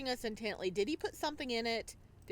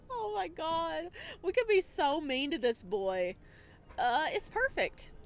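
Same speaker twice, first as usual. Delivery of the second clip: loud, high, giddy squeal; delighted at the thought of teasing him; excited; 6 semitones higher, 5 dB louder than usual. Real, not acted. Giggly glee at teasing the character.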